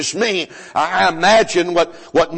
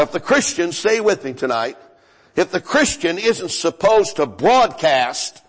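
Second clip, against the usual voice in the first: ordinary talk, nothing marked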